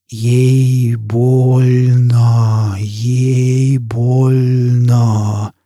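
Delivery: hugely stretched, droning syllables; distorted echo. A narrator's reading. Burning man's own voice burns his eyes, slow distorted drone. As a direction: draw every vowel out extremely slowly. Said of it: "Звук собственного голоса обжёг его глаза".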